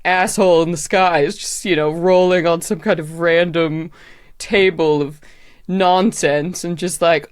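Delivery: normal talking voice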